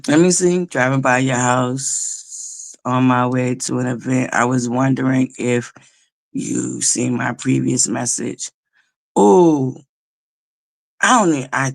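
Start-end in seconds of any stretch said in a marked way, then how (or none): 0.7-8.5 lower, slow voice for his message; reading his text. Retold or imitated. Reading a young man's text to viewers, lower and slow.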